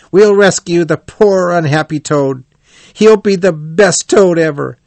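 fired up to save their friend; eager cry. A narrator's reading. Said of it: "cried the rat".

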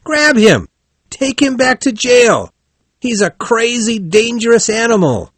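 very high, urgent shouted orders; outraged cry. A narrator's reading. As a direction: raise the alarm at full pitch. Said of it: "they cried".

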